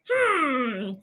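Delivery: lightly voiced pondering inventor, slow on the last words; a hint of a thoughtful old doctor wondering aloud; mild mock scientist voice, drawn out at the end; Doctor Kellogg musing. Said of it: "That's probably how he sounded".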